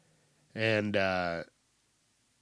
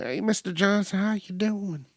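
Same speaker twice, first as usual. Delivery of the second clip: higher, sing-song greeting to a regular; friendly bank teller; 7 semitones higher than usual. Retold or imitated. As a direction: chirp it like a cheerful clerk. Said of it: "and they're like".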